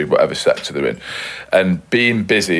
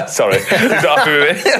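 laughing; 8 semitones higher than usual. Real, not acted. Laughing through an apology.

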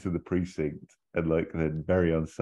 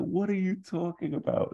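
baffled Ferrell. Retold or imitated. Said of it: "Ferrell's like".

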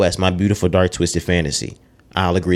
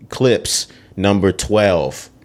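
usual speaking voice

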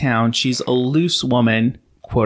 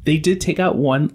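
ordinary talk

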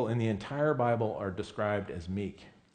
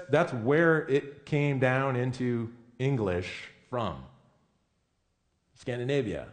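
usual speaking voice

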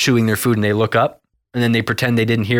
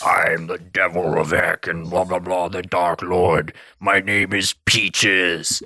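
slow, lower put-on devil voice; 3 semitones lower, slower than usual. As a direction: drag it out slow like a Dark Lord. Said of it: "the devil's like".

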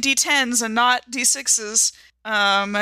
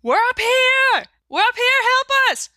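screaming for help; 4 dB louder than usual. Acted. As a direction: shout to the police with everything. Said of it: "I start screaming as loud as I can".

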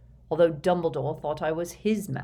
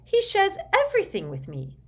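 very high, heated retort; shrieky, impassioned comeback; fired up; 6 dB louder than usual. A narrator's reading. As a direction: flare up in indignant pride. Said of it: "firing up at once".

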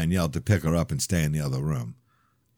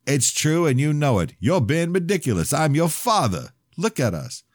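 high, louder pleading father; heated father; 9 semitones higher, 3 dB louder than usual. Acted. Father insisting to his estranged son, raised voice. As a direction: push hard, voice raised in argument.

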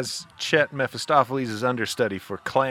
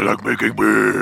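a touch lower, heavier voice; voice drops; 6 semitones lower, 4 dB louder than usual. Retold or imitated. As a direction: drop the voice down low. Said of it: "his voice drops".

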